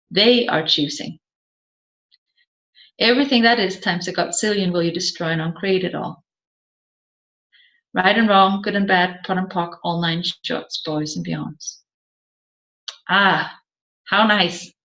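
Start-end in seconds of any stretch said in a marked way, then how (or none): 3.0-11.8 recited clearing statement. Acted. Facilitator reciting the clearing statement to the class, fading rote chant.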